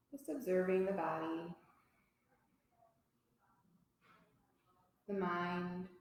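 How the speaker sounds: soft, unhurried yoga teacher voice with long pauses; guided relaxation. Real, not acted. Teacher guiding a yoga class to notice body and breath, calm guided relaxation. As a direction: settle them gently into stillness.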